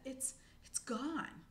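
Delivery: slow halting words; slow shocked squirrel; stunned. Acted. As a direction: let it sink in slowly. Charette shocked.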